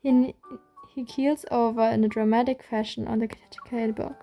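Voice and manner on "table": plain reading voice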